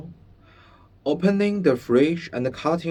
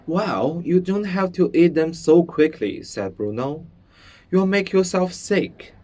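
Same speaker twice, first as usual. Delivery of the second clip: higher, louder mild scolding; chiding boy; 6 semitones higher, 4 dB louder than usual. Acted. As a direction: tease a friend for gulping. Bruno gently scolding.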